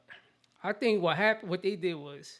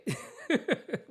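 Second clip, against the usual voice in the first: short laugh; 6 semitones higher than usual. Real, not acted. Laughing at her teleprompter slip.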